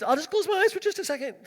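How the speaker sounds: high, quick little voice; imitated self-excusing driver. Tired night driver talking himself into a nap, high mock-innocent voice.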